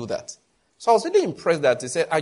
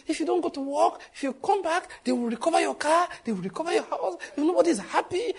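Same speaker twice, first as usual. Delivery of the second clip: higher, teasing reply to auntie; mock retort; 11 semitones higher than usual. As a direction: tease her back, much higher. Retorting to aunt in quote.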